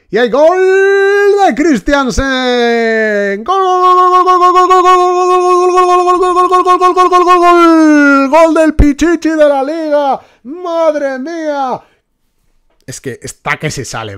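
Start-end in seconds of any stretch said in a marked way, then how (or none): 0.1-11.8 shouted, long drawn-out goal call; football commentator. Pretend. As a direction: stretch out every gol like a radio commentator. Mock goal commentary bursts.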